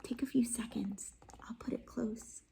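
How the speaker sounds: soft hush to the class; whisper. Real, not acted. Teacher quietly inviting students to look at the page, whispered. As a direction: breathe it so they lean in.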